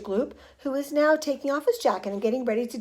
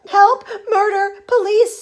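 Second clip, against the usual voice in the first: high, very loud alarm cries; screaming; 11 semitones higher, 13 dB louder, slower than usual. A narrator's reading. Mother seeing son shoot up pipe, screaming. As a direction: cry out each word in panic. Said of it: "screamed Missus Gloop".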